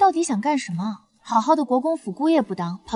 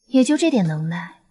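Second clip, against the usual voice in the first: ordinary talk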